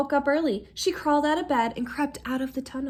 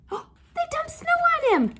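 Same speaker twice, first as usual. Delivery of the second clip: delighted squeal. Real, not acted. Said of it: "reader cries out".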